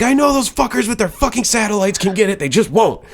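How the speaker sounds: high-pitched retold tirade; indignant rant. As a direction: rail at them in a worked-up comic rant. Retold rant at phone companies.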